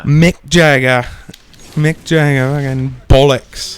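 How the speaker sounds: slow, bad British accent; Don Cheadle. Piling on British references, in a bad British accent. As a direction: draw out each slang word in mock British. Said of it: "If you're really bad at a British accent".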